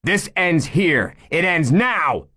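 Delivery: high, loud, slow shouted vow. Acted. Chief shouting at the hiding gunman, slow loud vow. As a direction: ring it out slowly across the street. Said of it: "Nate shouted".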